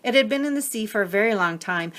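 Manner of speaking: plain reading voice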